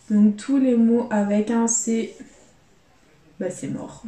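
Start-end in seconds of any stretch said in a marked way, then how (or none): 3.4-4.0 a soft resigned whisper under the breath; deflated whisper. Real, not acted. Scratching a losing crossword, muttered disappointment. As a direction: breathe it out, beaten and quiet.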